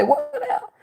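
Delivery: squeaky high outraged question; mock shock. Telling how people react to grandmother's retirement, high mock shock. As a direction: shriek it in disbelief. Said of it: "you say".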